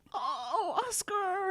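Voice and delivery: high, cooing "Oh, Oscar"; imitating a man fawning over a guide dog; doting coo. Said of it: "he comes up and goes".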